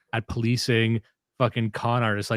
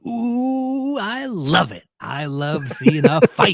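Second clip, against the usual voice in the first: gleeful delight; 7 semitones higher, 5 dB louder, slower than usual. Acted. Gleeful over an online fight.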